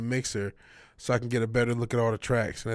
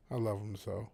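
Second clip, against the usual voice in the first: tender; 5 semitones lower, 10 dB quieter than usual. Real, not acted. Fond words about his dog.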